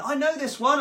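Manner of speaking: high, loud delighted outburst; hammed-up festival yell; drunken excitement. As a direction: re-enact a tipsy fan recognising the song. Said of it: "'cause I'm a bit drunk".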